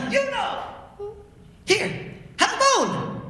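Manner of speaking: put-on old man rewarding his hound; old man calling. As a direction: hand the dog a bone with a big call. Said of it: "and he said".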